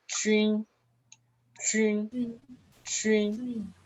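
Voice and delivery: "trin" repeated on a high, level tone; pronunciación modelo. Shown as a demonstration. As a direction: model "trin" high and flat for learners to echo. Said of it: "esta aquí es". ("trin" agudo y plano, para repetir)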